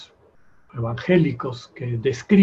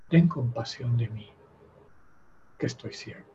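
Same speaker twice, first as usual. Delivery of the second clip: soft pleading prayer, much softer than the talk; hushed prayer; 2 semitones lower, 6 dB quieter than usual. Pretend. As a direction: pray it quietly like the blind beggar. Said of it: "clamando al Señor". (oración suplicante en voz baja)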